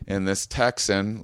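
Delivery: ordinary talk, nothing marked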